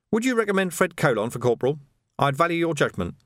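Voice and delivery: lightly voiced sergeant-at-arms; businesslike superior. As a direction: consult a subordinate as an equal. Vimes consults his sergeant.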